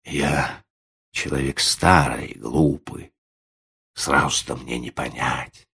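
halting, humble, slightly low; hesitant old man. A narrator's reading. Old man confused before the lady, humbly hesitant. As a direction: excuse yourself haltingly, with pauses. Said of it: "замялся Ладышкин".

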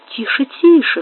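ordinary delivery

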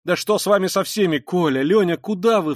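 high, loud sudden alarm; frightened cry. A narrator's reading. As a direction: call out in a fright after the children. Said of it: "вскрикнула она вдруг в испуге". (испуганно вскрикнуть)

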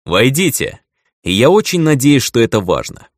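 loud, irritated command; displeased. Acted. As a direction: call them in with annoyance. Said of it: "недовольным тоном произнёс".

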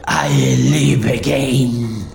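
low, slow voice from beyond the grave; a slow, hollow voice from the grave; Caleb's undead voice. Retold or imitated. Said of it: "con la misma voz de ultratumba de Caleb".

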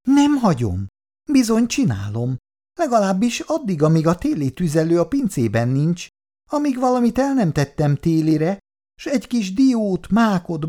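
high, insistent arguing; defiant mother. Acted. Anya dacosan vitatkozik férjével. (dacos, magas hangú vitatkozás)

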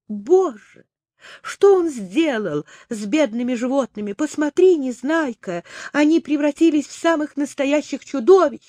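high, shocked and frightened; horrified cry. Acted. Polly sees friends turned monsters.

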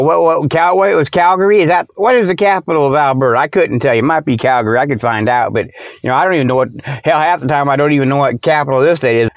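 ordinary delivery

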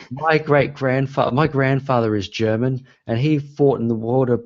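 high, outraged protest from the crowd; indignant audience heckler. Retold or imitated. Dad heckles the play from the audience, mock outrage. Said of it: "he gets up and he goes".

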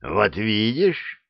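ordinary delivery

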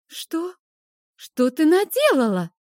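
high, loud cry of horror; horrified. Acted. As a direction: gasp it out in terror. Yena horrified at broken vase.

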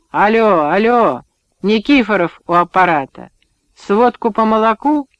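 loud, barking into a bad phone line; shouting into the phone. Chairman shouting into a crackly phone, loud. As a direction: yell down the line to the district office.

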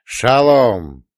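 called greeting. A narrator's reading. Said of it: "крикнула дуэтом".

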